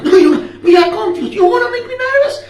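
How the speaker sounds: hammed-up protest, pitch shooting up; mock indignant. Interrogator denying he is nervous, mock indignant. Acted quarrel over who is nervous.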